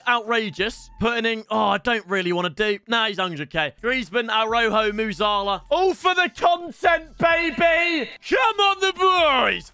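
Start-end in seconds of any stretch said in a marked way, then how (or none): 5.7-9.7 hype shout. Real, not acted. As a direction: pump up the viewers. Hyping up pack opening.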